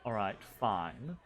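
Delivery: low, sighing reluctant concession; resigned noblewoman. Acted. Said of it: "she just kind of sighs and she says".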